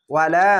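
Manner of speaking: "ওয়ালা" with the final লা held long; one-alif stretch. Shown as a demonstration. Teacher demonstrating the alif, "ওয়ালা" with long লা. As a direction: draw out the end of "ওয়ালা". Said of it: "এক আলিফ টেনে পড়বেন".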